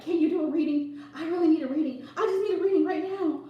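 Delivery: pleading, a touch whiny client voice; mock needy client. Tarot reader mimicking a pushy late-night client, pleading. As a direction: imitate the desperate texter begging.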